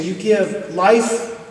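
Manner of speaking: each phrase drawn out slowly and weighed; slow emphatic appeal. Real, not acted. Father urging students to donate, slow and emphatic. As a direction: let every gift sink in, slowly.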